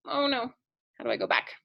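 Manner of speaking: normal talking voice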